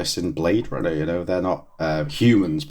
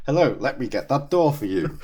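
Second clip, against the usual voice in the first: laughing; 8 semitones higher than usual. Retold or imitated. Retelling Mulder's awkward greeting.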